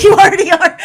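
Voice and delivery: high, loud laughing through the words; laughing delivery. Shown as a demonstration. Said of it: "Like that, just like that".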